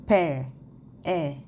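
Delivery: "Père" punched loud with open è, then "est" as bare è. Shown as a demonstration. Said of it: "Le son est plus ouvert". (« Père », puis « est », è bien ouvert)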